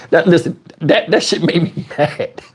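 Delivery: usual speaking voice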